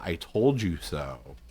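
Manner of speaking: low, slow dry dad voice; dad's ghost voice. Retold or imitated. Son recalls his ghost dad's smug remark, low and slow. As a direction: drawl it like a smug dead dad. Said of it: "the first legit answer that I thought of".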